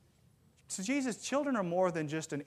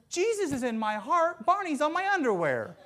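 put-on little girl's answer; 9 semitones higher, 5 dB louder than usual. Little girl answers the doctor.

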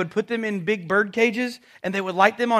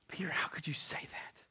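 whispered self-reproach; 5 semitones lower, 17 dB quieter than usual. Acted. Peter's inner voice scolding himself.

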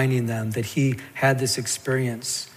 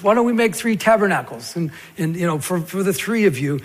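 eager, higher-pitched suggestion; flustered apostle; 5 semitones higher, 4 dB louder than usual. Acted. Preacher mimics Peter suggesting tabernacles, high flustered eagerness.

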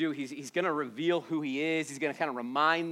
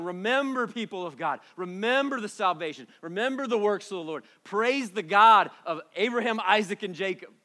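raised, insistent preacher's call; rousing exhortation; 4 semitones higher, 5 dB louder than usual. Acted. Preacher calls God's people to remember, rousing. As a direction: ring out each command, building in volume.

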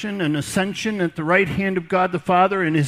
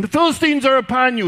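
loud, high cry of alarm; cried out; 6 semitones higher, 6 dB louder than usual. Retold or imitated. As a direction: call out the warning. Delilah alarm again.

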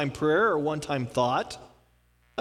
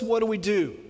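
normal talking voice

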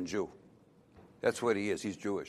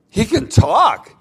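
higher, louder and slow, as if astonished; emphatic repeat; 10 semitones higher, 10 dB louder, slower than usual. Shown as a demonstration. The owner insists to the vet, loud and slow. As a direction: hammer it home the second time. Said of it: "And he can talk".

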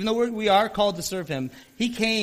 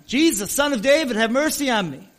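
loud, higher pleading cry for help; desperate yell; 4 semitones higher, 6 dB louder than usual. Retold or imitated. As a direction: call out to Jesus over the crowd, begging. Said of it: "this blind guy just starts yelling".

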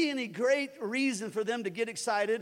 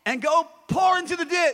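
higher, louder order; loud command; 7 semitones higher, 7 dB louder than usual. Shown as a demonstration. Pastor leading the declaration, loud. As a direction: command it to the ditch.

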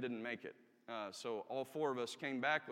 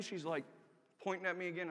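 ordinary talk, nothing marked

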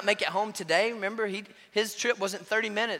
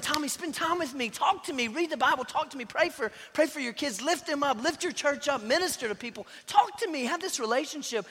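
higher, eager inviting voice; God calling warmly; 6 semitones higher than usual. Retold or imitated. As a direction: invite him lovingly and eagerly.

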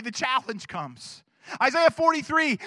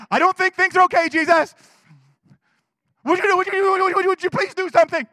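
higher, louder, pleading with mounting panic; panicked plea; 5 semitones higher, 6 dB louder, faster than usual. Retold or imitated. Disciples begging sleeping Jesus in the storm, frantic plea. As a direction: beg him to wake and act.

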